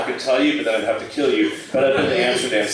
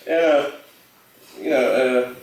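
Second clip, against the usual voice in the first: normal talking voice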